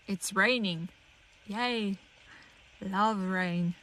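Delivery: unimpressed mock delight; subdued, ironic enthusiasm; sarcastic cheer. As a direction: say yay without meaning it. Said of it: "Not really".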